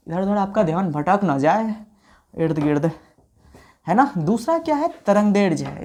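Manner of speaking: ordinary talk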